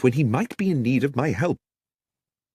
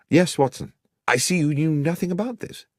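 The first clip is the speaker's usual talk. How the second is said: concerned friend; 9 semitones higher than usual. A narrator's reading. Cartwright voiced.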